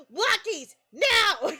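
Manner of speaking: high, loud mock demand; demanding cat. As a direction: yell it like an outraged pet imitation. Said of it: "he's losing his shit".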